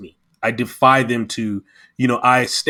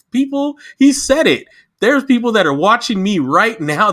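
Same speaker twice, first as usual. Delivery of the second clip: much higher, louder, fervently stressing he; heated plea to the audience; excited exclamation; 10 semitones higher, 3 dB louder than usual. Real, not acted. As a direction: shout it out with passion. Passionate emphatic exclamation.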